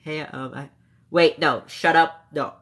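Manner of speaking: usual speaking voice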